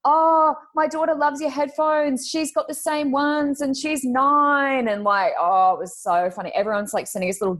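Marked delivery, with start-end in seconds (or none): 0.0-4.9 high, slow friendly mother voice; imitating friend. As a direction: lightly voice a chatty mum. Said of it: "she goes".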